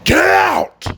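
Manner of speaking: sudden high-pitched bark to get out; yelling. Retold or imitated. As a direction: boss throwing people out of his office. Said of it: "And then he goes".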